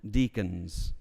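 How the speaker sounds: normal talking voice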